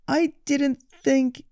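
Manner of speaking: put-on high, slow girlish voice; sheepish girl. Goldilocks admits to Little Bear, high and slow.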